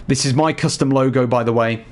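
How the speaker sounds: ordinary delivery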